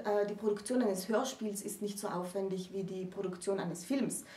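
normal talking voice

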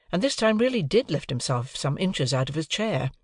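plain reading voice

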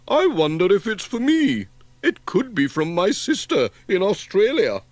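high, loud churchy voice; pious vicar. A narrator's reading. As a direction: intone it like a hearty parson.

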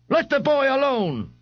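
high, pleading bystander voice; a bystander calls out in his defence; voice from the crowd. Acted. Someone in the crowd defends Ignatius, high and pleading.

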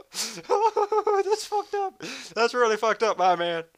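very high, slow laughing protest; a high drawn-out laughing that's fucked up; laughing disbelief. Real, not acted. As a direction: squeal through laughter.

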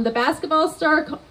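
normal talking voice

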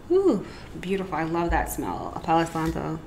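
ordinary talk, nothing marked

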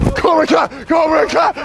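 high, loud rallying yell; mock battle cry. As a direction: shout it like a karate gang charging. Battle cry in play fight.

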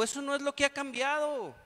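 firm retort; emphatic correction. Retold or imitated. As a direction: set her straight with weight. Priest correcting a woman.

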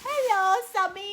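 high, loud, slow hello to husband; loud greeting. Real, not acted. Calling out hello to her husband, loud and drawn out.

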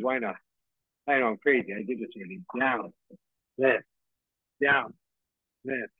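ordinary talk, nothing marked

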